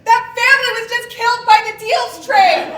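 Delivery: very loud, high, laughing outrage; outraged shouting. Real, not acted. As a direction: yell in disbelief. Horrified shouting at the ad.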